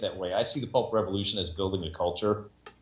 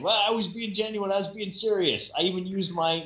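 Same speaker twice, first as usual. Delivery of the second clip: high-pitched feigned offence; mock protest; 8 semitones higher than usual. Co-host protesting at being laughed at, in a high mock-hurt voice. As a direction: plead sincerity, pitched well up.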